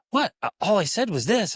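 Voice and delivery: put-on high-pitched, defensive kid's comeback; protesting kid. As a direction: imitate a child's indignant 'what did I do'. Kid protesting about his tone.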